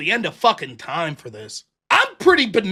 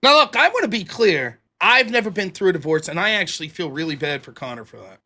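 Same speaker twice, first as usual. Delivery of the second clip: normal talking voice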